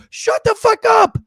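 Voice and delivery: high, loud, drawn-out outburst; slow, high-pitched angry yell; exasperated shout. Real, not acted. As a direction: yell it in fed-up disbelief. Exasperated outburst at mocked celebrity.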